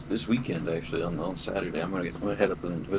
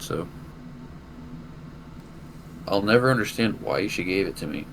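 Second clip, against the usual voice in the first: normal talking voice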